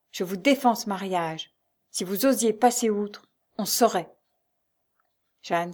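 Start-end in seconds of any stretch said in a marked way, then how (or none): none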